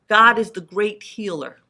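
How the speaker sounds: usual speaking voice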